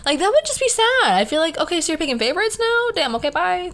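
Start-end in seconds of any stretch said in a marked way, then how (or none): 1.6-3.7 high, quick, offended brush-off; mock-wounded, storming off; hurt sass. Playing a partner offended at being shut out, high and sassy.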